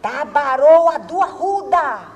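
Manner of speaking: loud, high, slowly stretched mock insult; imitated angry professor. Professor insulting the failing pupil, imitated furious drawn-out shout. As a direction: hurl each word out long and loud. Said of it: "coisa ruim pela brabeza, pelo gesto".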